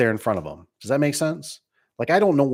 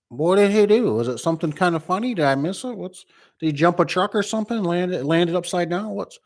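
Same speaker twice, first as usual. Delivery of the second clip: rising, higher and higher confused questions; imitating his puzzled self, getting higher; mock bewildered; 6 semitones higher than usual. Retelling reading the message, climbing into baffled disbelief. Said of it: "at first you're kinda like".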